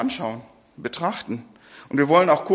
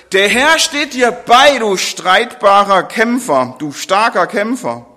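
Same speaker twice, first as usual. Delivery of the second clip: loud, high proclamation of an angel; angel's greeting; 4 semitones higher, 6 dB louder than usual. Acted. Angel hails Gideon the fighter, loud and ringing. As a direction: announce it like a heavenly messenger.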